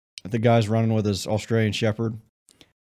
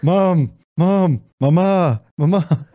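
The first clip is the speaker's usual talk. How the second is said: higher, louder, drawn-out calls for mom; Family Guy kid imitation; 7 semitones higher, 6 dB louder, much slower than usual. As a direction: pester mom like a cartoon child. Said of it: "Uh, Family Guy".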